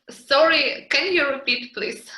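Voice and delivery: ordinary talk, nothing marked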